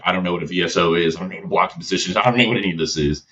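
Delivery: rattled off fast with a laugh; rapid-fire. Retold or imitated. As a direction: rush through it, half laughing. Panicked rookie listing confusions.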